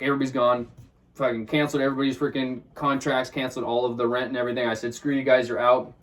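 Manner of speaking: ordinary talk, nothing marked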